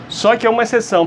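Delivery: usual speaking voice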